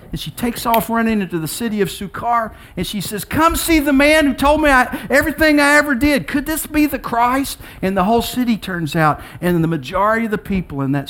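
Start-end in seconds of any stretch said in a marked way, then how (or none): 3.2-7.6 high-pitched urgent announcement; acted breaking of amazing news, pitched high; excited calling out. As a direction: call the whole town to come and see. Woman runs to tell the city.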